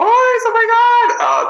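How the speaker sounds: very high, drawn-out gushing; thrilled high-pitched outburst; excited squeal. Real, not acted. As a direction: squeal it with delight, stretching the words. Asked if excited for Pride.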